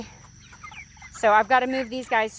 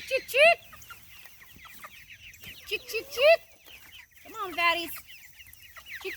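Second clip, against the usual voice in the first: high repeated chick chick calls; calling chickens; 5 dB quieter than usual. Real, not acted. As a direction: coax the flock along in a high call. Calling chickens along behind tractor.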